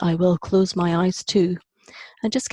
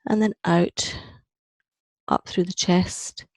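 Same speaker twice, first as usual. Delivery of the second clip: measured meditation voice with long pauses; guided meditation. Acted. Teacher leading a group down through the breath, guided meditation. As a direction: guide each breath in short, spaced-out phrases.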